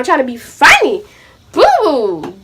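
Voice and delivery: normal talking voice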